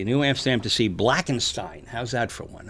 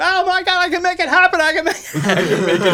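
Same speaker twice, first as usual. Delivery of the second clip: much higher, louder thrilled exclaiming; freaked-out excitement; 7 dB louder than usual. Retold or imitated. Heckler thinks he controls the film, thrilled high exclaiming. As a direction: gasp in giddy disbelief. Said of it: "freaked out. He's going".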